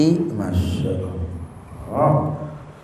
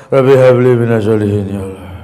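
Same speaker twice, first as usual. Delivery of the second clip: pleading prayer; 6 semitones lower than usual. Shown as a demonstration. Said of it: "Pesawat take off".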